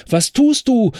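high, loud alarmed shout; screaming rider. Acted. Trampled paladin screaming at his horse, high loud shout. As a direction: cry out in panic. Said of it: "schrie der Paladin".

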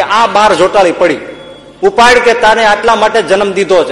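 high-pitched, impassioned retold urging; a mother spurring her son to fight; rousing command. મા દીકરાને લડવા લલકારે. (માનો જુસ્સાભર્યો, ઊંચા સૂરનો લલકાર)

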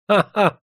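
high, spiteful acted ha-ha; sneering burst of scornful laughter; bilious mocking laugh. A narrator's reading. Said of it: "разразился жёлчным смехом". (жёлчный смех)

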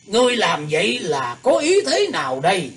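put-on shocked, furious old man's yell; angry shout. A narrator's reading. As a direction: bark it out in outrage. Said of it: "kinh ngạc lẫn tức giận quát lớn".

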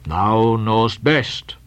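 low, slow, tomb-like voice; deep, solemn old Quaker; sepulchral reply. Acted. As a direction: answer as if from the grave. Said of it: "was the sepulchral reply".

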